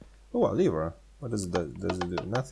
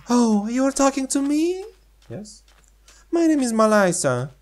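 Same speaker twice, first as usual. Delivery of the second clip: put-on higher kid voice for dialogue; high character voice; 9 semitones higher than usual. Chatting with the neighbour girl in a high kid voice. Voicing characters in game chat.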